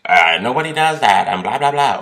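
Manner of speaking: loud sneering mock of critics. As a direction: taunt the doubters, loud. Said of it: "don't tell me".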